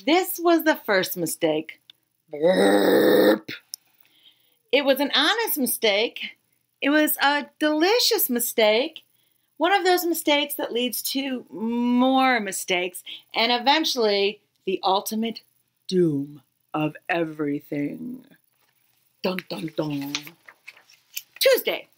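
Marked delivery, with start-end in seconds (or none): 2.3-3.5 burp. Acted. Llama ate all cake. 15.9-20.1 ominous doom. Acted. Ominous narration.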